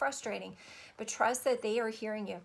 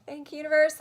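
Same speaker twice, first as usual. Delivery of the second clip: elated thanks; 6 semitones higher, 9 dB louder, faster than usual. Real, not acted. Joyful thanks to the universe.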